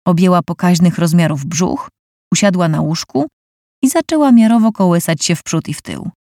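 usual speaking voice

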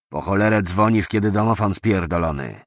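a surly thug spitting the words out; gruff thug snarling. Acted. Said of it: "wycedził Byczek". (opryskliwy osiłek cedzący słowa przez zęby)

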